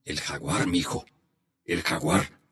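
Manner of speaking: grave y bajo, casi en secreto; revelación en voz baja. A narrator's reading. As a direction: suelta el nombre como un secreto. Abuelo revela el misterio. (el abuelo revela el jaguar en voz baja)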